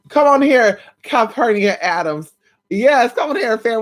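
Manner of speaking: loud, high hype call out. Real, not acted. Hyping Calpurnia Adams to the viewers, loud call. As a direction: call her in loud, like an emcee.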